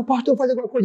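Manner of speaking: high-pitched yell without much volume; retold cry for help. Wife begs the pastor as husband smashes things, mock scream. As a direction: imitate someone crying out for rescue. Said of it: "ela gritava".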